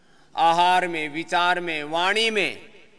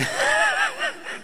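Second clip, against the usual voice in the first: high burst of laughter; 7 dB quieter than usual. Real, not acted. Laughs at students' faces.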